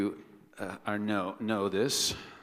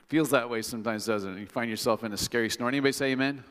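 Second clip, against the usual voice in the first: ordinary talk, nothing marked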